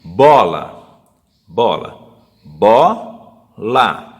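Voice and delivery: Bola said loud, split into bo-la; teacher sounding out bola for the children; splitting syllables. Shown as a demonstration. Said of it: "vamos pegar uma aqui".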